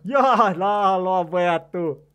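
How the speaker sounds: long drawn-out, very loud whoop; real gamer's cheer at a bite; excited shout. Real, not acted. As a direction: yell in triumph, stretching every word. Fish takes the bait.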